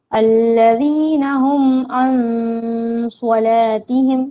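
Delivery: slow, drawn-out Quranic chant; Quran recitation. Shown as a demonstration. Teacher reciting the verse for students, slow chant. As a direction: recite each word slowly, holding the long vowels.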